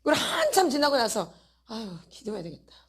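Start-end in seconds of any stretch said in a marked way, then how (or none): 1.7-2.7 soft mock sigh. Voicing a belated realisation.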